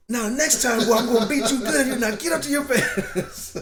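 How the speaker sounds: high, loud mock-angry father warning; stern dad threat. Dad warning his son after he fell, mock-threatening and loud.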